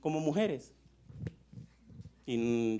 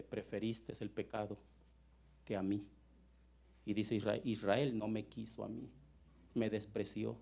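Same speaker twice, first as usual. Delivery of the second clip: quietly hurt God; 8 semitones lower, 10 dB quieter than usual. Acted. Voices God rejected by Israel.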